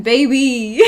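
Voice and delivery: a hammed-up New York baby, with a rise at the end; New York accent. Shown as a demonstration. Streamer says "baby" to chat in a playful New York accent. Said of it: "So I always say it with an accent".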